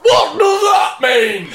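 high, slow, straining Yorkshire shout; hammed-up Yorkshire driver in agony; Yorkshire accent. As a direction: push out each word in broad Yorkshire. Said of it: "in a thick Yorkshire accent".

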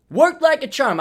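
high, loud gloating repeat; triumphant. Real, not acted. To listeners about drying the windowsill, loud triumphant crowing. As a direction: crow it out, proud of the fix.

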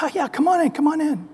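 high, soft, welcoming God; a lightly voiced, beaming God. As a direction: throw the door open as a kindly God.

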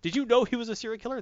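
high-pitched mock yell; half-voiced indignant shout. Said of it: "then he actually yells to the Night Shift".

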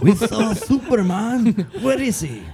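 high-pitched mock-excited locals in English; awed onlookers. Locals taking the rider for a superhero, high excited mimicry. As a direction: imitate thrilled villagers, voice way up.

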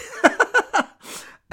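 breathless high cackle of a laugh; high wheezy laugh. Real, not acted. Host laughing at his own podcast name. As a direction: let a squeaky laugh burst out.

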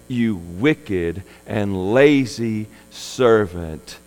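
stern master. Acted. Master condemns the servant.

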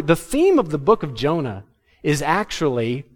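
normal talking voice

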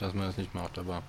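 ordinary delivery

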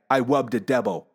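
"love the devil" as "wub de debbo"; speech impediment. Retold or imitated. Four-year-old insisting to his dad, "love" mispronounced as "wub". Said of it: "really heavy speech impediment".

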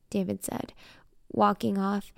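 usual speaking voice

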